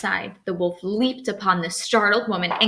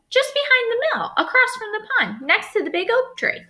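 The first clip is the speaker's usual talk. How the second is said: put-on much higher girlish voice; little girl; 4 dB louder than usual. Girl tells the wolf the way, much higher. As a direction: chirp the directions like a small child.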